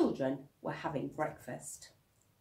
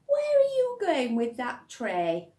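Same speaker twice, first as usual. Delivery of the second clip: put-on high, loud child asking; a curious little kid calling out; child's voice; 10 semitones higher, 9 dB louder than usual.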